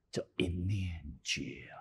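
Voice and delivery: 一念觉 breathed out in a whisper; a sudden soft whisper of insight; hushed whisper. Acted. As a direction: whisper it like a secret revealed. Preacher drops to hushed revelation.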